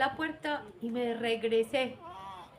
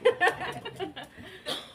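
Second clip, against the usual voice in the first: laugh; 8 semitones higher than usual. Real, not acted. Laughs at own story.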